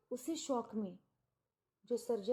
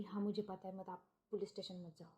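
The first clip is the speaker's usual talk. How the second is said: softer, lower, quick urgent plea; hushed plea; 4 semitones lower, 6 dB quieter, faster than usual. Retold or imitated. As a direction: hold him back in a low hurry. Daughter hushes him from police.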